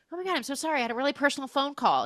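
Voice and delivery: high, fast mock excuse; flustered excuse. Teacher excusing herself to the principal, flustered babble.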